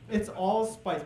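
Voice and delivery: ordinary delivery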